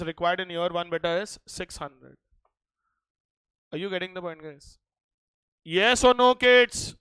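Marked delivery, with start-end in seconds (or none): 5.6-7.0 loud call to the class. Real, not acted. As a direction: raise the voice to check in. Teacher calling out to class.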